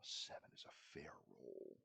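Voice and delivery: soft, low unvoiced whisper; whispered aside. Real, not acted. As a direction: murmur it to yourself. GM notes roll aside.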